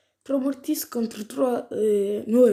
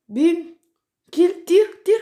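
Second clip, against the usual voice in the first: high, loud urging at the screen; shouting at the game in excitement; excited yelling; 9 semitones higher, 9 dB louder, slower than usual. Real, not acted. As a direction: egg the character on, shouting. Urging on his brawler mid-fight.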